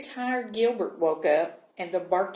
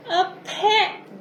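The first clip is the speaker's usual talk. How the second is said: much higher and louder, thrilled; happy glub; 11 semitones higher, 6 dB louder than usual. A narrator's reading. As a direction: burst out with delight like a tiny fish. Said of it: "Gilbert glubbed happily".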